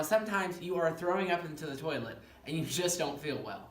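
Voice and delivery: normal talking voice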